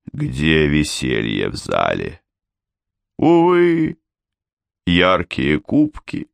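slow solemn poem recital; recitation. A narrator's reading. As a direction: declaim it like an old elegy. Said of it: "продекламировал".

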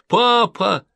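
put-on high, loud child's wail; a boy calls for his father; child crying out. A narrator's reading. Boy cries out to the sergeant in a high wail.

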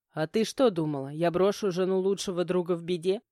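ordinary delivery

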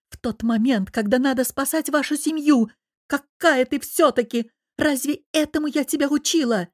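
rising indignant scolding; reproachful. A narrator's reading. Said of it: "упрекнула".

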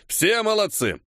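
high-pitched director's call; commanding praise. A narrator's reading. Director praising everyone after the take, commanding. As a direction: call praise to the whole cast. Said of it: "скомандовал режиссёр".

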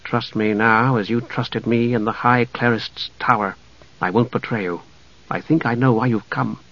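soft, confidential appeal; low tones. A narrator's reading. Half-elf asks the knight's trust, in low tones. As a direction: keep it hushed and earnest. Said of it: "he said in low tones".

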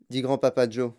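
plain reading voice

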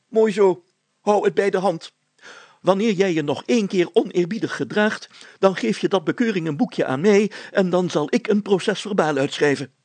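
high, loud self-important mayor voice; pompous mayor. A narrator's reading. Mayor threatening Brilstra with a fine, pompous and high. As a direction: lecture him like a puffed-up mayor.

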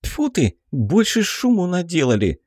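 put-on high, scornful snort of a girl; young woman voice. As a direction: scoff at the fuss, high. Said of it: "фыркнула Белка".

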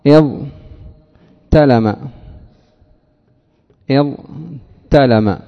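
اضطلم drawn out very slowly, ض then heavy ط; very slow demo word. Shown as a demonstration. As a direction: sound out اضطلم at a crawl. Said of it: "الضاء".

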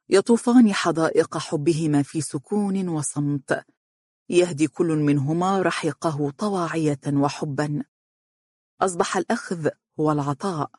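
ordinary delivery

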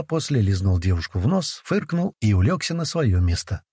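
ordinary delivery, nothing marked

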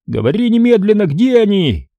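high, insistent interrogation; urgent demand. A narrator's reading. Moose sitting on Joe's stomach, urgent demand. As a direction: demand an answer this instant.

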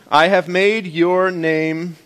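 I have made your name read very slowly. Shown as a demonstration. Preacher reads slowly so congregation can clap. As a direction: leave room for claps after each word. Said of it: "I'll read it slow so you can get your claps in".

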